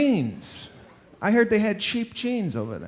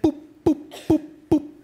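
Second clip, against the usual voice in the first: high mock beeping, boop boop; playful beep imitation, pitched up; scanner beeps; 8 semitones higher than usual. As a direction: chirp it like a checkout scanner.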